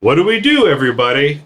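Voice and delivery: high-pitched urgent mock drill questions; hammed-up alarm, pitch jumping up; mock fire-drill alarm. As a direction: play an office prankster quizzing everyone in an emergency. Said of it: "Dwight sets fire in the".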